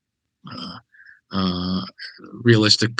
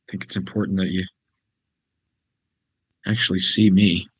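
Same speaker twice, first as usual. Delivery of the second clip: ordinary talk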